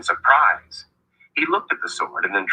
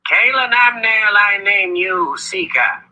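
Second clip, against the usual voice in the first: put-on grand old wizard voice; 13 semitones higher than usual. Wizard names her Seeker.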